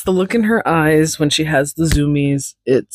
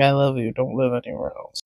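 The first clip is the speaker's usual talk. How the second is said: tender; 3 semitones lower, 7 dB quieter, faster than usual. Real, not acted. Tender apology to cat.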